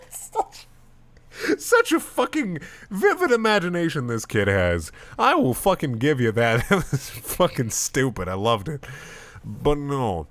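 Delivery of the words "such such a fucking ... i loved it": higher, strained laughing speech; laughing through words. Real, not acted. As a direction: talk while still cracking up. Still laughing after fanfic.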